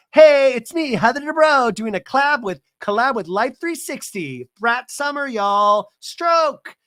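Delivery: high, loud hammed-up influencer pitch; mock Heather plugging the app, high hammed-up ad voice. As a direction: sell it like a cheesy spokeswoman.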